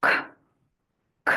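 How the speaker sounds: isolated sound খ for the letter c; k sound demo. Shown as a demonstration. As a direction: give খ as a short clipped consonant. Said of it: "first সাউন্ডটা কি".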